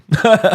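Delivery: sudden high laugh; short, bright laugh out loud; high burst of laughter. Real, not acted. Laughing at own joke.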